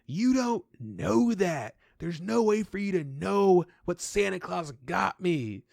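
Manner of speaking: very slow, high retort; mock a kid outsmarting his dad; defiant kid. Imitating a kid defiantly challenging his dad about Santa. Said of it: "He goes".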